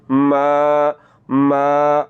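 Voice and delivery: "मा" held long on the vowel; lengthened meem. Shown as a demonstration. Teacher shows madd munfasil, long-held vowel in मा. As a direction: stretch "मा" steadily. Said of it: "मीम को थोड़ा सा लंबा".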